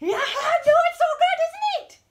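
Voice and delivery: very high, loud squeal at the dog; high excited pet voice; dog talk. Real, not acted. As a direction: gush at the dog at full pitch.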